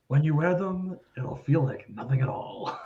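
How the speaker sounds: low, soft seductive ad voice; sensual ad read. Retold or imitated. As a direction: purr it like a sultry radio ad. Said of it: "the way he says it is so funny and so sensual".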